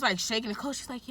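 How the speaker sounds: ordinary delivery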